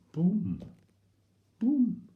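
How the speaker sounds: loud, high booming call; big boom. Shown as a demonstration. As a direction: belt out the boom like a drum hit. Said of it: "It needs a boom".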